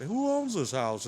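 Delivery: slow, put-on voice of a man coming up; mocking imitation. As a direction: drag it out as a mock nosy visitor. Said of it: "he wants to imitate Paco Guapo's channel".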